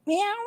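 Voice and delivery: small high meow; squeaky imitation meow; imitated cat meow. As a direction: mimic a breath that meows like a cat. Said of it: "One breath went".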